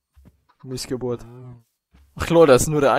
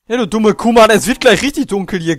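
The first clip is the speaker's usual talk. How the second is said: raised annoyed yell; yelling across the room; 5 semitones higher, 5 dB louder than usual. Real, not acted. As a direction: yell at the cow to move. Shouting at stubborn cow.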